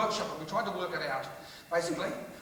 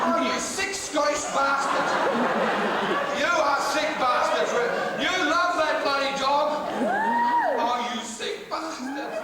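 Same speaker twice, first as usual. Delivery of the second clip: mock outrage; 6 semitones higher, 6 dB louder, slower than usual. Comic mock outrage at audience.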